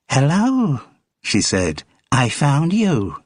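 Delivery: high, slow American fan voice; American woman. Acted. American fan greets her idol Kenny outside rehearsal, high and eager. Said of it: "Her accent was American".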